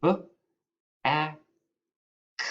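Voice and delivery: "B, a, k" as buh, short a, k, far apart; sounded out. Shown as a demonstration. Teacher writes the first syllable back, sounding out B, a, k separately. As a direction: sound out B, a, k one at a time. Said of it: "My first syllable".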